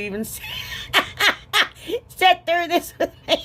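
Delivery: high, helpless giggly voice; laughing. Real, not acted. Crafter laughing with viewers at her messy video, laughing. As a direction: push the words out through real laughter. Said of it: "I can't stop laughing".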